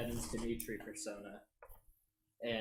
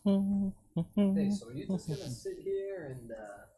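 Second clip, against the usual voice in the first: taunting character voice; 7 semitones higher, 11 dB louder than usual. Acted. Character taunting enemies mid-combat.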